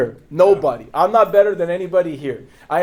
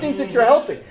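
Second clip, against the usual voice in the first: ordinary delivery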